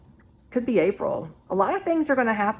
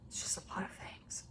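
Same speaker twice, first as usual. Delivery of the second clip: hushed, breathy aside; whispering; 18 dB quieter than usual. Real, not acted. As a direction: drop to a whisper. Trailing off about dark predictions.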